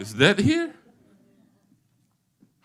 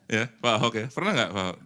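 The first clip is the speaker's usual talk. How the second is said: ordinary talk, nothing marked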